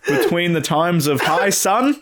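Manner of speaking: high, slow mock-archaic decree; drawn-out, hammed-up proclamation; old-time lawgiver. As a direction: intone it like an ancient law. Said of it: "in the eighteen hundreds, they're like".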